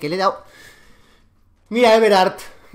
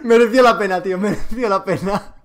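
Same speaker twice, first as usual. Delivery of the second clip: laughing; 5 dB louder than usual. Real, not acted. Laughing after stunt.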